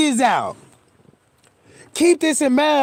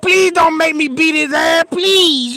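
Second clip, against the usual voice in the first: pleading; 4 semitones higher than usual. Retold or imitated. Begging principal not to whip friend.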